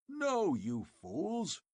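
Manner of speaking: exasperated roar. Acted. Conan roaring at the foolish tribesmen, exasperated. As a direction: spit each word out. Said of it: "roared the exasperated Cimmerian".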